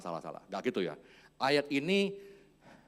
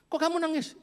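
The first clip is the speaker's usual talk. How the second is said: put-on man asking child; 13 semitones higher, 4 dB louder than usual.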